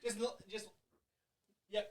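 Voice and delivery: straining, very quiet; grunting effort at a tight lid; opening a stuck jar. Real, not acted. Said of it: "struggling with the jar".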